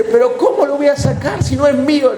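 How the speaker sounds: high-pitched imitation of a flustered woman; mock-flustered high voice; retold protest. Acts woman's protest to pastor.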